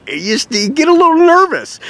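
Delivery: high, jittery imitation of a nervous buyer; mock nervous squeak. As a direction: squeak it out like a worried customer. Customer nervous about wiring money.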